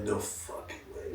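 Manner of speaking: hushed, slow thought aloud; soft acted amazement; inner disbelief. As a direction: breathe it slowly as a private thought. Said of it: "In my head, like".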